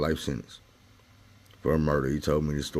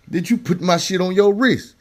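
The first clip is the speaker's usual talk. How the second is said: ordinary delivery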